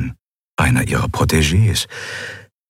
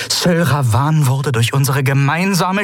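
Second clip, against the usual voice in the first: higher, every syllable cut sharp; sharp-edged warning; 12 semitones higher than usual. Acted. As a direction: warn him like drawing a blade. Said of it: "scharf wie die Klinge ihres Schwerts".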